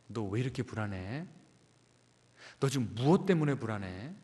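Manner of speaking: quiet, comforting inquiry without pressure; gentle self-soothing. Acted. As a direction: ask your own anxious heart tenderly. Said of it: "잘 돌아보고 감싸 안아주고 다독거려주는".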